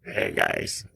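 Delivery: low rough gravelly growl. Retold or imitated. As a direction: rasp it out deep and gravelly. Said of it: "He's got a real gravelly voice".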